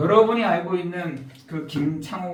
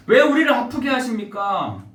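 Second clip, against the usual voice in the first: ordinary delivery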